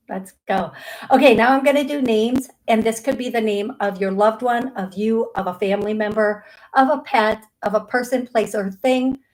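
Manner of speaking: usual speaking voice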